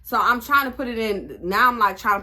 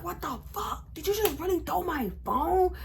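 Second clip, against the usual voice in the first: high mock-outraged boyfriend voice; boyfriend's outrage; 10 semitones higher than usual. Boyfriend after his phone is thrown, mock outrage. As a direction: mimic him squealing in outrage. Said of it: "So he's like".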